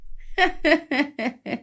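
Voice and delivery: light, high acted giggle; a string of short high giggles; acted laugh. Friend laughing at talk of kinship, giggling.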